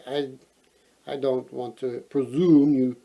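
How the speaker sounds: usual speaking voice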